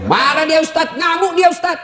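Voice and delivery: excited complaint. Retold or imitated. Man complains wife rages.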